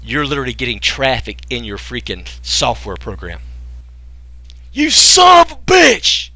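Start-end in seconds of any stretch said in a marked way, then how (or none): 4.7-6.3 very high, loud, drawn-out exclamation; excited outburst. Real, not acted. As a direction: blurt it out at full volume in delight. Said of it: "You guys just made me cuss".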